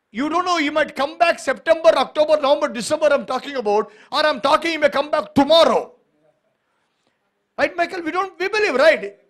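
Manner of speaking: rising fervent sermon; preaching. Real, not acted. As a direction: proclaim it to the congregation.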